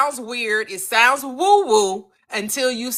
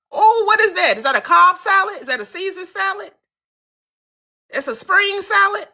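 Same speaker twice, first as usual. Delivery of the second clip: high excited playing along; 8 semitones higher than usual.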